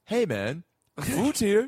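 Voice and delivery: high, cheery phone alert; phone notification voice. Retold or imitated. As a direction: chirp it like an app. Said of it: "your phone just goes".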